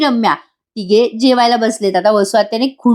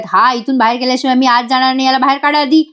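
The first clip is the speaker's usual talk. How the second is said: ordinary delivery, nothing marked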